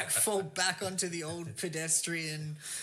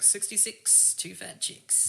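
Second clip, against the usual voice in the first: singsong bingo call, higher on sixty-six; bingo caller; 5 semitones higher, slower than usual. Retold or imitated. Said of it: "be like".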